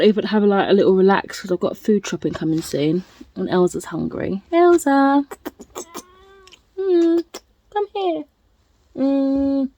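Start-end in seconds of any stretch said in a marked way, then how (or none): 4.5-5.2 calling the pet. Real, not acted. Calling her pet over. 6.8-9.7 coaxing pet voice. Real, not acted. Coaxing her pet close.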